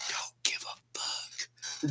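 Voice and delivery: a breathy whisper, slow and under the breath; whispered aside. Real, not acted. Tipsy guest whispering that he doesn't care. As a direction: whisper it like nobody should hear.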